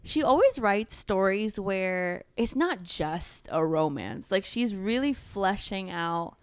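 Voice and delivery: normal talking voice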